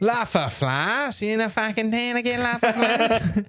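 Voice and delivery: high-pitched exasperated Aussie; bad Australian accent. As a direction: scold in a hammed-up Australian accent. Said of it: "doing an Australian accent".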